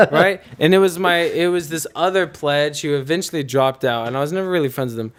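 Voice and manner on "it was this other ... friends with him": usual speaking voice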